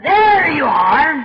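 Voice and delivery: loud, slow, drawn-out gotcha; triumphant challenge. Retold or imitated. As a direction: thrust it out like a man baring his chest. Man pushes out chest, challenging.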